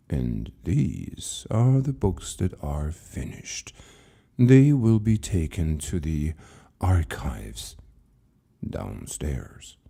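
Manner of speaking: an ancient librarian speaking with patient calm; calm old keeper. Acted. Keeper calmly answers frightened Lucas, low and slow. Keeper explains finished books.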